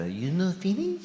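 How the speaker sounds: put-on a light Japanese accent, rising at the end. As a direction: gently mimic the waitress's Japanese accent. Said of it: "I have to do the accent".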